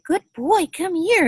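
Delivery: high, bright-pitched praise to a puppy; praising puppy voice. Shown as a demonstration. Trainer calling her puppy over in high praise voice. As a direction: call the pup in with sky-high praise.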